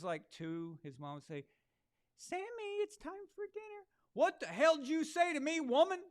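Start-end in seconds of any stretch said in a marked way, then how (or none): 2.2-3.9 high, sweet falsetto mom; mock mom calling. Mom voiced calling child. 4.1-6.0 put-on loud, high, furious toddler tirade; two-year-old Sammy snapping at his mom, mock furious shout; Samuel L. Jackson. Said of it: "talking like that".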